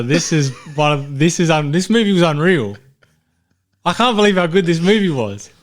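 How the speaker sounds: can't believe how good it was; excited. Real, not acted. Host raving to co-host about the film, excitedly.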